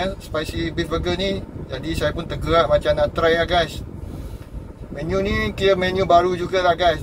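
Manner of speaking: muffled behind mask; speaking masked in car; through a face mask. Real, not acted. Seen 0: Surgical mask covers mouth and nose in every labelled frame.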